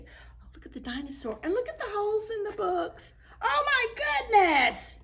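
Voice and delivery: high, quick delighted exclaim over the picture; excited gasp. Real, not acted. Reader shows children the picture, delighted and high. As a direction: gush at the dinosaur picture, high.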